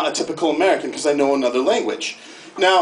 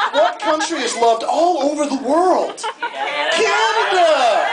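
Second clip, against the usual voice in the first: high, drawn-out gleeful Canada; spring the answer like a prize; gleeful reveal; 9 semitones higher than usual. Retold or imitated. Comic tells the crowd his answer, high and gleeful. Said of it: "And so I said".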